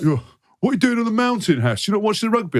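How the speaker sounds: high-pitched, incredulous fan impression; put-on disbelief, voice jumping up; shocked fan. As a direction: mimic a baffled rugby fan calling out. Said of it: "the shock when fans were like".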